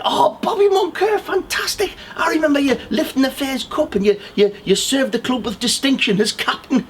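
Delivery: put-on a delighted old fan squeaking his praise; elderly fan voice. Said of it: "and he said".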